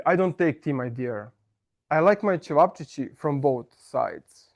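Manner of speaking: ordinary talk, nothing marked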